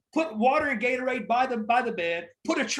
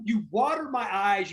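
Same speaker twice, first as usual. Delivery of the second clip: usual speaking voice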